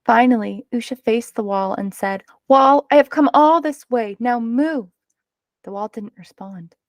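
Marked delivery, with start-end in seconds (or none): 2.5-4.9 higher, louder opening, dropping on the order; firm demand. A narrator's reading. Girl who has walked far orders the wall to move, firm. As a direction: order the wall aside, firmly.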